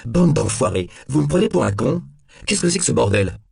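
high, loud angry outburst; outraged and cursing at full voice; indignant cry. A narrator's reading. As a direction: cry out, insulted, pushing pitch and volume up. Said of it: "s'écria Temple".

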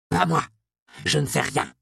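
voix de vieillard paniqué, de plus en plus aiguë et forte; vieillard affolé. Acted. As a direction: refuse en protestant, au bord de la panique. Vieillard terrifié répète son refus. (le vieil homme terrifié repousse l'apothicaire, d'une voix affolée)

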